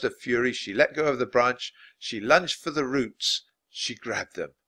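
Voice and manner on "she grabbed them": usual speaking voice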